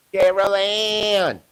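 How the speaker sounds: slow, drawn-out, eerie calling of Carol Ann; drawn-out mock call. Poltergeist parody, a slow, eerie final call. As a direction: stretch Carol Ann out slowly like a ghostly echo.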